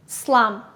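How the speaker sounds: teacher modelling slum; model word. Shown as a demonstration. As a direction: clear short slum.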